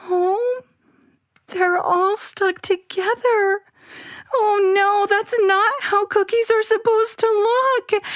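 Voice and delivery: put-on very high distressed child voice; upset child. Abby finding the cookie blob, upset. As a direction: close to crying.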